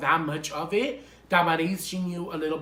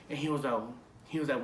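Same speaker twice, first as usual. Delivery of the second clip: ordinary delivery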